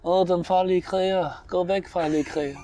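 slow, put-on dreamy voice; drawn-out, hammed-up fake dreaming; mock sleep-talker. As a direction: play at fighting a warrior in your sleep. Mimics feigned dreaming about a warrior.

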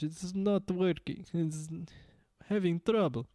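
high, halting mock-Russian accent; put-on Russian, struggling with the language; Russian orc accent. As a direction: play a dim orc with thick Russian accent. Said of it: "I think of just playing in Russian".

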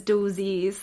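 "dozies" slowly, as DOO-zeez; imitated Scottish pronunciation. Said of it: "Nicola Sturgeon".

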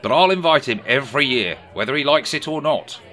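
Keir Starmer impression. A narrator's reading. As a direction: play Keir telling a story. Sir Keir voiced in story.

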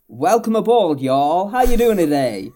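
jolly, loud Southern American welcome, pitched high; Southern American accent. Acted. Tram driver greeting passengers, hammed-up Southern American accent. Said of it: "in his Southern American accent".

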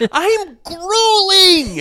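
very high, drawn-out mock bellow; mock garbled outburst. Co-host mimicking his garbled words, hammed-up wail. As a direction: howl GRUELING slowly, imitating his blunder. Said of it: "a couple of garbled".

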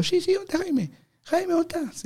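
very high imitated calling; high-pitched mock urgency; urgent searching call. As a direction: mimic a second searcher calling out. Others join the search, calling.

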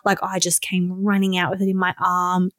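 ordinary talk, nothing marked

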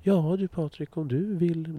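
high, sugary mock politeness; mock overly careful. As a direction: coax him as if he might break. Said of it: "det är så fint i kanten".

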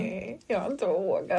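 quiet, slow mumble, half swallowed; sheepish mumble. Real, not acted. Admitting to her friend, slow embarrassed mumble. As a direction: confess it under your breath.

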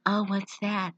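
soft monotone quote; quiet, unexcited line reading. Retold or imitated. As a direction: imitate her deadpan delivery. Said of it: "saying in this monotone voice".

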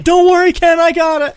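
squeaky, loud, hammed-up Izzy reassurance; shrill-pitched mock shout; mock high voice. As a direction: yell it way up high like a cartoon sidekick. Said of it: "He's like".

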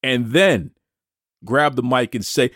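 ordinary talk, nothing marked

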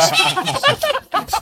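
a sudden high burst of laughter. Real, not acted. Remembering the coach mocking his sun excuse, laughing.

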